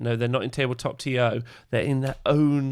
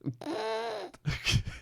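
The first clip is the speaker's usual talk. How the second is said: high laugh; 9 dB quieter than usual. Real, not acted. Disbelieving laugh.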